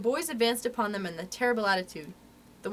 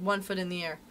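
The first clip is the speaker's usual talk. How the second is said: usual speaking voice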